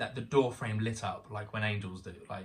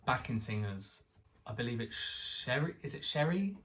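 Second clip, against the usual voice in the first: usual speaking voice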